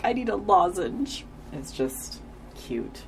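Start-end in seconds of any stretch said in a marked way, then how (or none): 0.0-1.2 lightly voiced, higher-pitched character; Andrew impression. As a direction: imitate him with a raised pitch. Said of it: "And then he's like".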